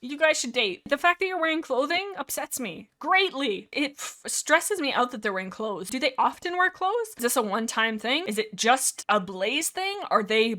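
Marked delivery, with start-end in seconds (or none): none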